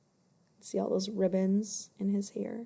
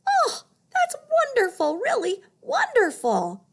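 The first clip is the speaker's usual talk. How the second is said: much higher, thrilled cry of joy; delighted lion; 14 semitones higher, 6 dB louder than usual. Acted. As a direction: squeal with delight at the new ribbons. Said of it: "he was all smiles".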